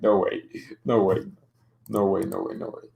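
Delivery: ordinary delivery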